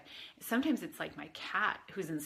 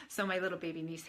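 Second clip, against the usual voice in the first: usual speaking voice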